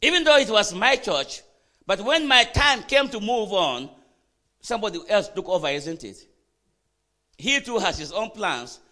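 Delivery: loud, high-pitched preacher's delivery; forceful preaching. Real, not acted. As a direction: preach it out hard to the congregation.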